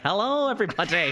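high, laughing mock greeting; Davina De Campo. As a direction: sing out a hammed-up diva hello. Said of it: "would be like".

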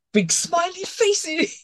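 high, loud and slow, hammed-up delight; mock over-cheery. A bank sending customers smiley faces, mock over-cheery. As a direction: gush like an email full of emojis.

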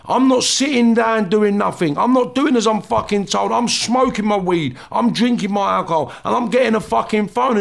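confrontational, pitch pushed up; defiant tirade. Acted. His tirade at the screws.

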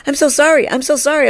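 high, frantic "so sorry"; flustered apology. Retold or imitated. Startled woman apologizing for barging in, high and flustered. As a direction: imitate an embarrassed stranger apologizing fast. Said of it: "and she's like".

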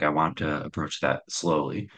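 ordinary talk

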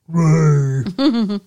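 lightly voiced sitcom brother calling Ray; a rough sketch of the brother calling out; loud but only half-done brother voice; Raymond's brother. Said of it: "we get to see Raymond's brother".

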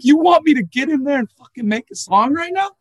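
high, loud disbelieving cry; mock shocked protest at the producer; incredulous outburst. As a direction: react like he's lost his mind. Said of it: "I'm like".

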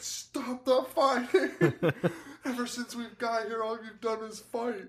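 hammed-up weepy mother; mock pleading mom. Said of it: "the mom finally is just like".

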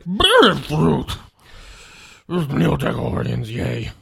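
lightly voiced old man, loud; a hint of an old man croak. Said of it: "that's gonna be my old man voice".

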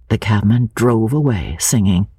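ordinary delivery, nothing marked

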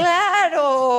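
high, loud, drawn-out exclamation; excited cry. Real, not acted. Host tells guest they are already on air, excited cry. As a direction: sing out the confirmation at full voice.

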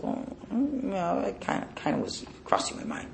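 soft, embarrassed admission; sheepish chassid. Retold or imitated. Chassid caught doubting his rebbe, softly sheepish. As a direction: mumble it, caught out.

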